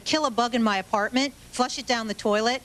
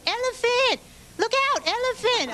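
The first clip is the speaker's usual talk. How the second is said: much higher, shrill-toned yelled warning at a skier; screaming warning; 12 semitones higher than usual. Retold or imitated. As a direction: bellow "elephant" as a ski-slope alarm. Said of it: "screaming at the top of her lungs".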